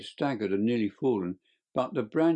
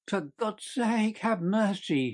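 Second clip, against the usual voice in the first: high, slow, desperate cry for mercy; shrieking plea; 6 semitones higher than usual. Acted. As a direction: beg for your life in a high shriek. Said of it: "he shrieked".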